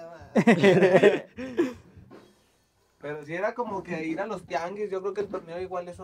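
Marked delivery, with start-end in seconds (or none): 0.0-1.2 drawn-out loud laughing "no mames"; retold exclamation, laughing. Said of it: "decía". 1.3-2.2 loud burst of laughter after the quote. Real, not acted.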